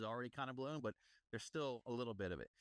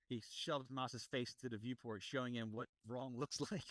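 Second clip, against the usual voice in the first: normal talking voice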